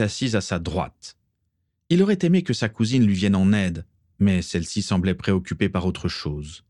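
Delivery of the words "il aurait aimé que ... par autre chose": usual speaking voice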